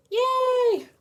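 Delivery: high, loud mock cheer; squealing yay for herself. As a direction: cheer yourself on.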